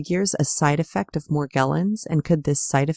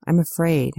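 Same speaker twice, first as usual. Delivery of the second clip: plain reading voice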